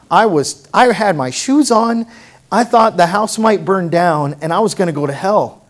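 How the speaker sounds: ordinary talk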